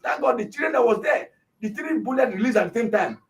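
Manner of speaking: ordinary delivery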